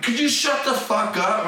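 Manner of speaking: high-pitched retold irritation; exasperated retort. His retort in retold quarrel.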